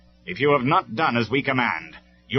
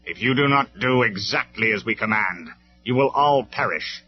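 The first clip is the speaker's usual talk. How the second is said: icy; 6 semitones higher, 8 dB louder than usual. Acted. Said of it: "in a voice like ice".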